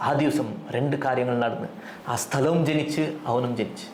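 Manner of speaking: dramatic Malayalam line, fast; Malayalam movie dialogue. Shown as a demonstration. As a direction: recite ആ ദിവസം like a film hero. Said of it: "If you are listening to it in Malayalam".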